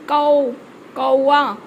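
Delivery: कौ with a gliding au, then कौवा; au sound. Shown as a demonstration. Teacher showing the au sign, demonstrated कौ. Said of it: "इसका sound आएगा".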